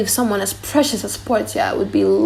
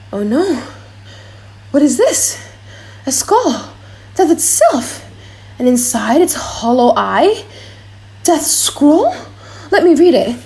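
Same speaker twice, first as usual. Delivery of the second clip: shocked dread; 5 semitones higher, 4 dB louder than usual. Acted. Prince finds a skull.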